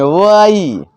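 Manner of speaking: वाई stretched very long and pressed; long stressed Y. Shown as a demonstration. Teacher showing a long vowel to children. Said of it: "थोड़ा सा जोर लगा के बोलेंगे".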